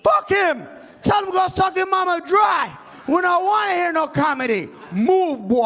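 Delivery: high, shouted mock Jamaican heckle; acted angry heckler shouting; heckling crowd. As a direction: boo the comic off at a yell. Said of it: "All I heard was".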